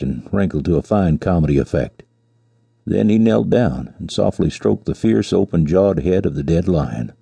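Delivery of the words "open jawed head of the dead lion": plain reading voice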